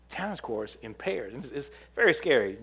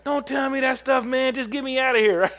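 mock scared kid; 11 semitones higher, 6 dB louder than usual.

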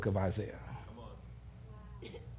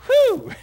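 high, loud exhaled whew; relieved whew; 13 dB louder than usual. Retold or imitated. Camp leader relieved the big counselor took over, high loud whew. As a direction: let out a big comic sigh of relief. Retold relief after friend walks off.

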